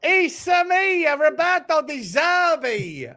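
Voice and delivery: high, slow, hammed-up Italian voice "It's a me"; loud mock Italian manager accent; generic Italian accent. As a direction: stretch it out like a cartoon Italian. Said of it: "a generic Italian accent".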